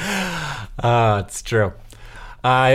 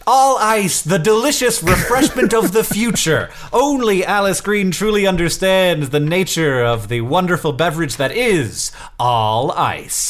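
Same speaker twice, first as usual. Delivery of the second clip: mock ad read; 4 semitones higher than usual. Brother spoofs a sponsor for his niece's drink, mock ad voice. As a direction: sell the drink like a cheesy commercial.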